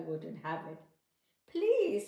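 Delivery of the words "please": much higher, louder mouse plea; pleading mouse. Acted. Mouse begging the bride, high and loud. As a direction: beg like a little mouse. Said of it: "said the mouse".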